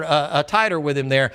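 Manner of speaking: ordinary talk, nothing marked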